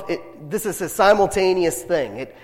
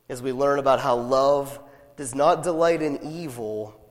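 ordinary talk, nothing marked

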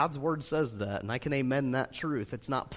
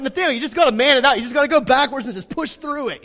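coaching shout; 10 semitones higher, 11 dB louder, much faster than usual. Retold or imitated. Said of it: "I'm like".

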